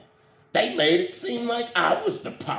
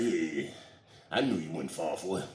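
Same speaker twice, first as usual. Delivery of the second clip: low chuckling pastor; 9 semitones lower, 7 dB quieter, faster than usual. Retold or imitated.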